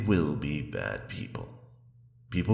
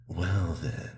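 ordinary talk

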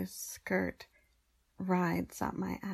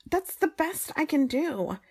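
annoyed; 8 semitones higher, 7 dB louder than usual. Acted. Annoyed after failed take.

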